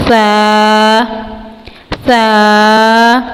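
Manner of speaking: سا with the tongue tip slightly out between the teeth; tajweed letter demo. Shown as a demonstration. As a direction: put the tongue out a little for سا. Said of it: "زبان کو دانتوں سے تھوڑا سا باہر نکال کر". (سا زبان تھوڑا باہر نکال کر)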